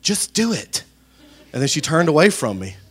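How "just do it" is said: high-pitched imitation of an impatient wife; mock the "loving, gentle" wife's curt order; exasperated wife. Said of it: "who is so loving and so gentle, she said".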